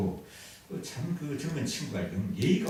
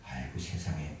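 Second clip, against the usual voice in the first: 깜짝 놀라서; 9 semitones lower, 7 dB quieter than usual. Acted. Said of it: "깜짝 놀라면서 이렇게 얘기합니다".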